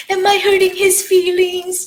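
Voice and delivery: high-pitched anxious self-questioning, mocked; mock worried voice. Mimics women's needless worry.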